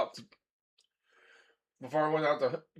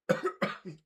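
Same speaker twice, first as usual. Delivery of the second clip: choked cough; 9 semitones higher, 4 dB quieter than usual. Real, not acted. Coughing on spicy food.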